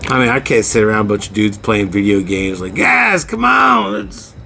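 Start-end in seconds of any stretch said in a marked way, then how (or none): 2.8-4.3 shouted slow pro wrestler hype; wrestling promo. Acted. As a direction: yell it like a wild wrestling promo. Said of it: "that would be my wrestling voice".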